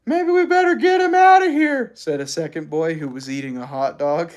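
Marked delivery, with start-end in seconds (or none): 0.0-1.9 put-on high boyish voice, a little anxious; a kid nervous about his snack; worried boy. A narrator's reading.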